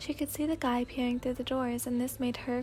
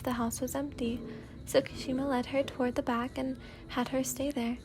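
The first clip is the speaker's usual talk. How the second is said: ordinary talk, nothing marked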